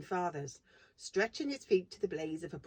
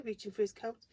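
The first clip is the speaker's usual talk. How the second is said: plain reading voice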